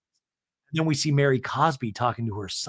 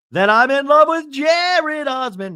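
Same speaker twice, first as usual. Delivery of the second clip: high, loud, slow mock confession; host fakes a Brittany quote, slow, high and loud; Brittany confessing; 10 semitones higher, 6 dB louder, slower than usual. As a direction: gush it like a melodramatic reveal. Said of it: "she's like".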